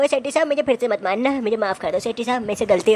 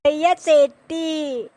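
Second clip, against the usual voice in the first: put-on whiny child; 5 semitones higher, much slower than usual. Child naming the bully.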